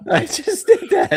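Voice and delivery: very high, laughing, slowed; laughing disbelief. Real, not acted. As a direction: crack up in disbelief. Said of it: "Oh my God".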